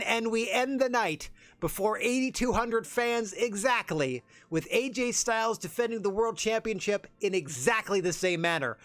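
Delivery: slow, lifted wrap-up; announcer recap. Acted. Commentator recapping the night's title defenses, slow broadcast voice.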